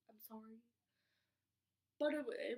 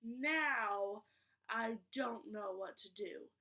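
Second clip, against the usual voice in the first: exasperated; 8 dB louder, slower than usual. Retold or imitated. Exasperated at the worker.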